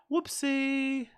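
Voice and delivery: high playful squeak on Whoopsie; high mock squeaky oops. Mock oops after volume mistake.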